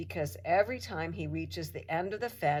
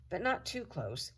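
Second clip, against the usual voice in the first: usual speaking voice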